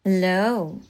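low, soft breathy greeting; breathy whisper. Acted. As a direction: breathe the hello softly. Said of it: "said Alma in a breathy whisper".

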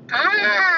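long, loud high-pitched scream; hammed-up piercing wail held long; mock scream. Man mid-act in a crude story, long mock scream.

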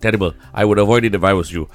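ordinary talk, nothing marked

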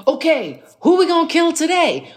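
high, laughing, gleeful; eager mock ride-or-die. Loyal friend eagerly offering to help with trouble, high gleeful mock voice. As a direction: pitch up like a too-keen accomplice. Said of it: "the ride or die is like".

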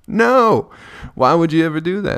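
incredulous. Real, not acted. Incredulous reply after laughing.

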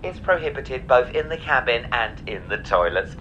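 airline announcement. Acted. Mock in-flight safety announcement.

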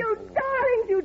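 high gushing exclamation. Acted. Guest greets her dear friends on arrival, high gushing. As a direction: gush over them, delighted.

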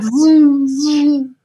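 high, loud whooshing zoom, zoom; lightsaber zoom. Pretend. Guest playfully mimics lightsaber swings, high mock whoosh. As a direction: swing an imaginary lightsaber with your voice.

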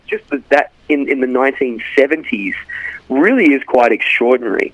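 ordinary talk, nothing marked

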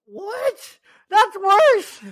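high shocked outcry. Real, not acted. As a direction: squeal in disbelief. Dismay at a harder word.

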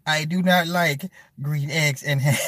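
slow, deliberate storybook reading; measured word-by-word children's book cadence; Dr. Seuss read-aloud. Acted. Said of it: "in that very same inflection".

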